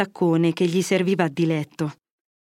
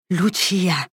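exclaiming. A narrator's reading. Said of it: "sclamò il padre".

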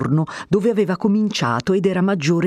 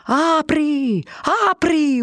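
crowd yelling; 10 semitones higher, much slower than usual. A narrator's reading. Crowd outside demanding the door open, yelling. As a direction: hammer the word at the door. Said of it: "urlava la folla".

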